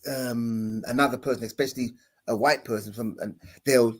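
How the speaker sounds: usual speaking voice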